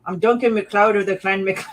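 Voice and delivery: lightly attempted Scottish Highlander accent. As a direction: announce it like a Scottish movie hero. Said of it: "that accent was excellent".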